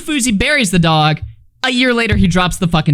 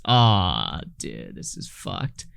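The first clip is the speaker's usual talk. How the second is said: much softer, slow and low; dismayed groan; 12 semitones lower, 9 dB quieter, much slower than usual. Real, not acted. Streamer reacting to chat, dismayed and much softer. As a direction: let it sink away under your breath. Reacting to chat's dark joke.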